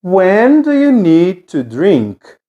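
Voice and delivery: slow, even teacher model; teacher modelling for the class to repeat; slow model sentence. Shown as a demonstration. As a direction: say each word slowly so students can copy. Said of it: "Repeat after me".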